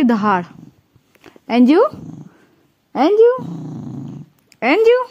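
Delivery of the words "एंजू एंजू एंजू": high, loud repeated calls of the name; rising calls across the room; repeated calling. Real, not acted. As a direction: keep calling Anju to get her attention.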